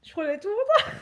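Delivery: high-pitched laughing wail; laughing through pain. Real, not acted. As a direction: wail it out through helpless giggles. Laughing through pain, protesting her innocence.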